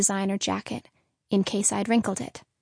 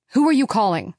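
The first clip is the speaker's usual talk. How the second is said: loud, sharp demand; demanding father; 7 dB louder, faster than usual. Acted. Father loudly demanding who mother is calling. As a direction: confront her about the call. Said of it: "he demanded".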